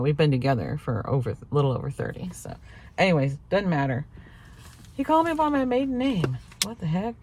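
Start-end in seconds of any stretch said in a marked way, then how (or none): 4.9-7.1 high incredulous repeat; disbelief. Real, not acted. Wife repeating what husband called her, incredulous. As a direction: say it up high, can't believe it.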